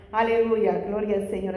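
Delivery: usual speaking voice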